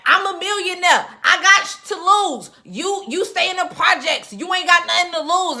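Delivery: loud, high-pitched retort with attitude; JoJo's boast. Retold or imitated. Recapping JoJo's brag at Veronica, loud and haughty. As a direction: shout it as a haughty put-down. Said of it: "But JoJo was like".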